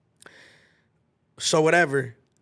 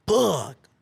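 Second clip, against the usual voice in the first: loud, high burst of fuck; dismayed curse; 5 semitones higher, 5 dB louder than usual. Retold or imitated. Learning a gang waits for him, dismayed. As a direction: it hits you all at once. Realizing the danger.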